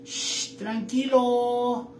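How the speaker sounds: hissed shh then a soothing, drawn-out tranquilo; a hint of a calm snake charming him; talking snake. Snake calming the rider, hissing then soothing. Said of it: "La víbora dice".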